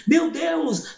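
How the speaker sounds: high astonished gasp of meu Deus; shocked. Retold or imitated. Doctor, hand on her head, crying out in shock. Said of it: "colocou a mão na cabeça".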